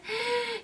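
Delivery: high, sudden gasp of dismay; startled gasp at the bad news; sharp gasp. Real, not acted. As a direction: suck in air in shock. Finds item out of stock.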